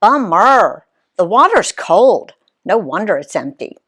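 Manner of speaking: ordinary delivery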